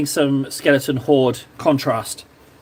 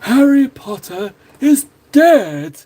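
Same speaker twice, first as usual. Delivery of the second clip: higher, louder, very slow gloating villain voice; Voldemort impression; 8 semitones higher, 5 dB louder, much slower than usual. Second try, heart in it, hammed-up gloating dark lord. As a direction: drag it out with hammy menace. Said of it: "It's not a good impression".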